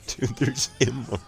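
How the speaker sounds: laughing warning. Real, not acted. Spectator telling dude there's Immortal, laughing. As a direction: warn them while cracking up.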